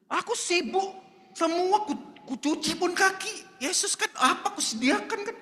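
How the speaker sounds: high, whiny busy-hostess voice; complaining Martha. Acted. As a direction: whine about all the work. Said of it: "Kenapa santai kali si Maria ini?".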